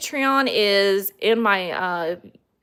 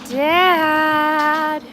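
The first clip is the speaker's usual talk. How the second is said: high, loud, long-held call; 7 semitones higher, 5 dB louder than usual. Real, not acted. Calling to Dad over a loud noise, drawn-out shout. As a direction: stretch the word into a raised call.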